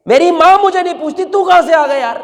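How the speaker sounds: put-on high, tearful cry of a young man who has been cast off. A drunk youth, weeping, asks the old man why he came. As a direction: voice breaking as he asks who the stranger is. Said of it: "رونے لگا".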